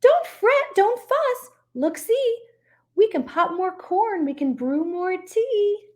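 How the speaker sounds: high squeaky soothing voice; squeaky mouse. Acted. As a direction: soothe him like a tiny mouse. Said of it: "Mouse squeaks".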